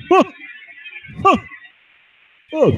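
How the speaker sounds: high mocking huhs, playing dumb; mock puzzled grunts. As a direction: grunt like he has no clue, mocking him. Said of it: "he just goes".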